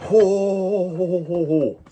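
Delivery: high hammed-up Santa laugh. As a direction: boom out a jolly ho ho ho.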